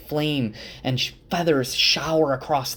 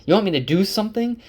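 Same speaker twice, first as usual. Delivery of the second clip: fast, much higher and louder, furious; angry outburst; 10 semitones higher, 5 dB louder, much faster than usual. Retold or imitated. Rand, furious at Egwene, shouts the challenge fast and high. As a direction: fire the question back at her in a rage. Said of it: "Rand gets angry".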